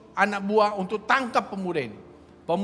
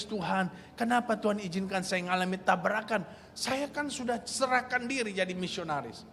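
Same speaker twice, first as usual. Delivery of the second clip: much softer, hushed complaint, mildly tearful; crying to God; 3 semitones lower, 11 dB quieter than usual. Retold or imitated. Young man asking God why he crashed, hushed and tearful. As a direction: imitate a quiet half-sob of self-pity. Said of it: "Jadi pemuda dia nangis".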